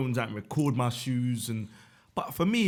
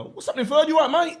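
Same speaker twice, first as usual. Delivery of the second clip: loud, high matey greeting; put-on sweet mate; 14 semitones higher, 7 dB louder, faster than usual. Playing a sweet mate greeting Third, loud high voice. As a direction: call out like an overfriendly mate.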